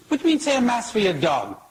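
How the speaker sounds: fast, high-pitched mock outrage; acted priest bristling at an absurd request; indignant, outraged. Priest answers man wanting a mass for his dog, indignant. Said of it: "Father's indignant, he's outraged".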